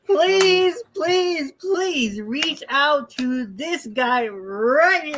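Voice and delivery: high, drawn-out pleading; mock begging. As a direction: beg theatrically, stretching out each plea. Mock begging to contact the other host.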